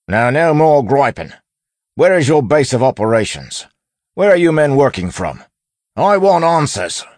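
loud, high, barked interrogation; constable voice. A narrator's reading. Wayne interrogating the bandit for those outside, loud constable voice. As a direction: demand answers in a booming constable voice. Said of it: "resuming his constable voice".

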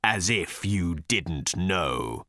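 slow, low, menacing rumble. A narrator's reading. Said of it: "in a low, menacing rumble".

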